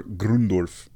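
"Grundorf" slow, with a German oo in the first syllable; original German pronunciation. Shown as a demonstration. Host giving the family's original name, "Grundorf" said with an oo. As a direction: say "Grundorf" as Groon-dorf, drawn out. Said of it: "whose name was originally Grundorf".

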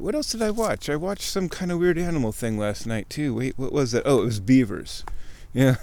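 normal talking voice

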